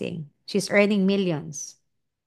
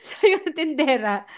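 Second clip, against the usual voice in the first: words spilling through laughter; laughing; 7 semitones higher than usual. Real, not acted. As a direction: talk while still laughing. Laughing while speaking. (nagsasalita habang tumatawa)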